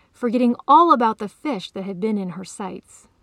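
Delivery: usual speaking voice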